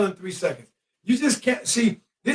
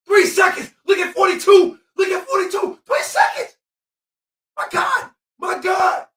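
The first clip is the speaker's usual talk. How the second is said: much higher, loud, disbelieving; exasperated fan shout; outraged yell; 9 semitones higher, 3 dB louder than usual. Real, not acted. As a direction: scream at the refs. Outraged at uncalled violation.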